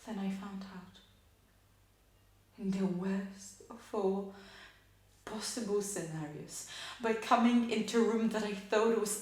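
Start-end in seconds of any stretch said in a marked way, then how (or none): none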